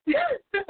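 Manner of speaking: high burst of giggling; cracking up. Real, not acted. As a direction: break into a helpless laugh. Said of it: "I'm cracking up".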